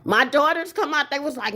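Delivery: ordinary talk, nothing marked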